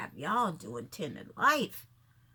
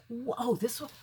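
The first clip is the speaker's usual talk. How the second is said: ordinary talk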